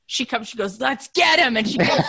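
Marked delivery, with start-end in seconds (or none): 0.8-1.6 mock gremlin, pitched up; hammed-up little troublemaker rallying the others; gremlin toddler. Mom imitating three-year-old rallying sisters, high gremlin mischief. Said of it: "it's like a gremlin".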